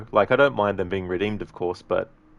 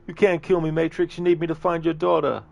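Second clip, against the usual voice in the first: high-pitched, pleading movie henchman; desperate captive pleading; 10 semitones higher, 4 dB louder than usual. Acted. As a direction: plead for your life in a high voice. Captive pleads in acted movie scene.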